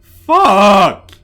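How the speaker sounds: long, high, loud curse; frustrated yell. Acted. Dragged away against his wishes.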